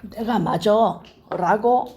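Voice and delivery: slow, drawn-out "I'm right"; slow, stubborn insistence; self-righteous insistence. Retold or imitated. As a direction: lean into it like someone sure they're right. Voicing a self-righteous claim.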